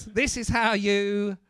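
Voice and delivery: ordinary talk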